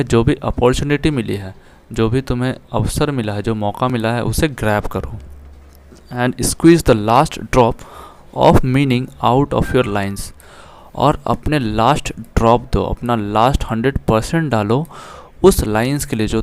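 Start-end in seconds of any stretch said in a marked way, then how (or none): 6.1-10.4 slow, careful reading aloud of the English line; slow text reading. Shown as a demonstration. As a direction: read each word out deliberately for the class. Reads English text aloud slowly. (धीमे, साफ़ अंग्रेज़ी पाठ-वाचन)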